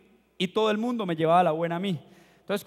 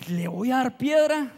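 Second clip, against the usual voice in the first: higher, slow incredulous question; mock disbelief; 4 semitones higher, 3 dB louder than usual. Boy questioning the advice to use a stone, imitated disbelief.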